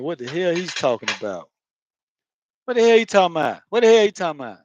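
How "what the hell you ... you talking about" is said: high, loud, slurred Florida question; Florida accent. Shown as a demonstration. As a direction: belt it out in Florida slang, words run together. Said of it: "That's how we do it down the way".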